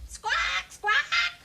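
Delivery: high, loud bird squawks; shrill bird screech. A narrator's reading. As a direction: screech like greedy birds. Greedy birds squawk.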